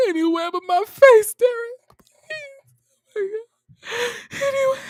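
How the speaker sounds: hammed-up sobbing plea, very high; terrified begging. Woman begging Derek not to burn her face, hammed-up tearful terror. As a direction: wail and gasp in mock terror, pitch way up.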